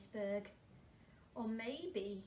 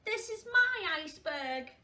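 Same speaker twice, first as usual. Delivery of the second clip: loud, higher, possessive; bossy claim; 5 semitones higher, 9 dB louder than usual. Acted. Girl claiming the iceberg from the penguin, loud and possessive. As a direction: stake your claim loudly. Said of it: "said Tofter".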